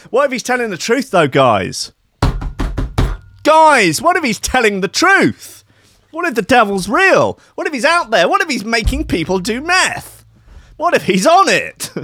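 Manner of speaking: high, breathless mock alarm that the devil is real; mock paranoid. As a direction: spiral into mock paranoia, guys. Mock conspiracy panic to listeners.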